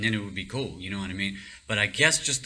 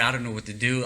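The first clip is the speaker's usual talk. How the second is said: ordinary talk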